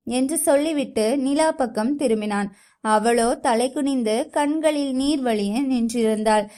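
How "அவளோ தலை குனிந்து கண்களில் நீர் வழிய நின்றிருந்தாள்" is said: plain reading voice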